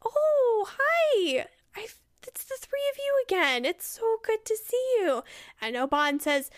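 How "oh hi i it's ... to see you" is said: high, sing-out delighted greeting; Faust. Acted. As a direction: pitch way up, feigned innocent delight. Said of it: "and then they say".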